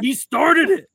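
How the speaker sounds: put-on high, loud whiny kid voice. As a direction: whine it like a blamed child. Kid blaming sibling.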